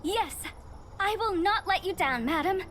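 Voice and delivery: high-pitched, keen acknowledgement; eager obedience. Acted. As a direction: answer like a dutiful subordinate taking orders. Subordinate accepts orders from madam.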